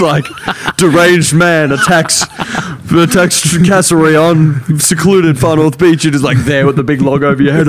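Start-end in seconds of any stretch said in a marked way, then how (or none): 0.8-6.0 slow, raised mock headline voice; headline reader. Friend imagining his mate in the headlines, mock announcer voice. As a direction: proclaim it like a sensational headline. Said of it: "imagine you on the headlines".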